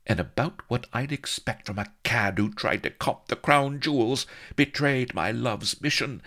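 high, melodramatic lady's tirade; indignant demand. A narrator's reading. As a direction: tear into him, pitched high throughout. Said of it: "she demanded".

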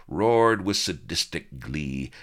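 usual speaking voice

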